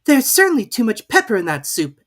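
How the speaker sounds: put-on high, pleading girl's voice; a polite girl asking; young Alice. A narrator's reading. Alice sneezing.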